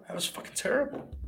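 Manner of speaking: half-whispered, high disgusted verdict; exasperated. Real, not acted. Streamer judging the match to chat, breathy disgust.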